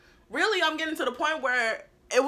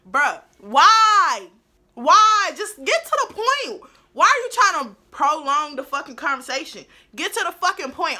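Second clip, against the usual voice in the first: loud, higher, exasperated demands; angry rant; 5 semitones higher, 9 dB louder than usual. Real, not acted. Vlogger ranting at her manager, loud and fed up. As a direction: vent at him, fed up.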